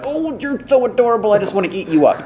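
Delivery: put-on high-pitched baby-talk cooing; gushing grown-up. Grown-ups fussing over child actor, mock gushing. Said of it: "other grown-ups were like".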